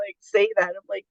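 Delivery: normal talking voice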